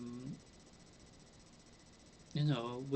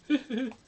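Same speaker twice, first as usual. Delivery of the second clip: short laugh; 10 semitones higher, 6 dB louder than usual. Real, not acted. Laughs at store item.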